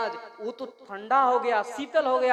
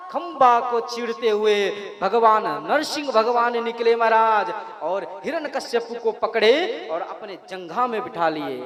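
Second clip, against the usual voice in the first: ordinary talk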